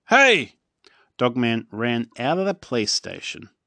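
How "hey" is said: high, loud call after the dog; chief calling out. A narrator's reading. Chief calling after running Dog Man, high and loud. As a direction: shout after him as he runs.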